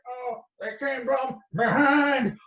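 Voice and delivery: slow, high mock distress call; doomed pilot. Pilot crying out as he's hit, mock panic. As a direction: draw it out in a high comic voice.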